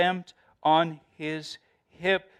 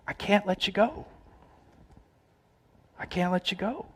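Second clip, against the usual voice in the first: tender plea; 5 semitones higher than usual. Acted.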